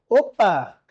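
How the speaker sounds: usual speaking voice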